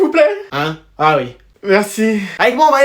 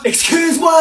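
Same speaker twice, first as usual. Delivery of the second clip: shocked excuse-moi; 7 semitones higher than usual. Acted. Outraged at dad cooking.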